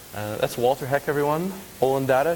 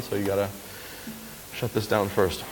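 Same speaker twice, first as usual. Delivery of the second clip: ordinary talk, nothing marked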